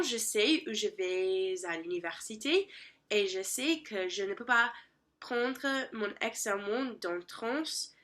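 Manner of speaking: ordinary talk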